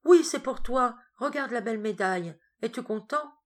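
a head doctor gently offering a medal to a patient; paternal. Acted. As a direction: coax him like a father with a child.

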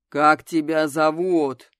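slow, deep interrogator voice; low rumbling voice. A narrator's reading. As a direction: rumble the question slowly. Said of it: "изменил голос до низкого, глубинного, ракочущего".

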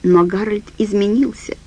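plain reading voice

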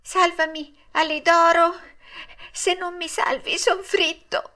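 tiny, high, feeble plea; small puppet's faint, high-pitched begging; faint little voice. A narrator's reading. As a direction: cry for rescue in a small high voice. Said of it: "una vocina fioca fioca".

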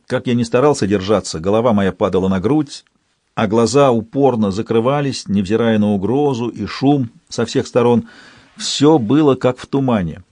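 plain reading voice

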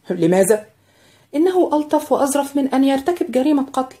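lightly voiced anxious girl; young maid Greta. A narrator's reading.

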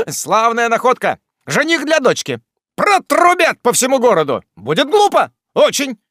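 high, loud jeering lines; high gloating taunts; gleeful mockery. A narrator's reading. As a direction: rub it in with glee. Jingle mocks the family.

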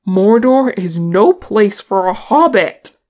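loud, firm protest. A narrator's reading. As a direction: state it forcefully, no argument allowed. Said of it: "said Balin firmly".

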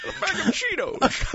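hammed-up Connery asking for snacks; mock Connery accent. Host extending the Bond joke, mock Scottish.

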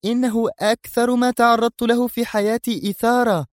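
breathless with wonder; girl carried away with excitement; dazzled. Acted. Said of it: "تستطرد في انبهار".

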